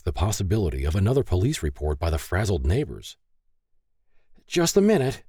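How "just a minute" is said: high-pitched nervous stalling. A narrator's reading. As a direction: call out to buy time. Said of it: "she stalled".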